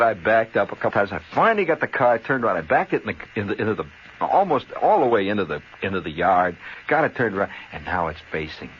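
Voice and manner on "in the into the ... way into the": ordinary delivery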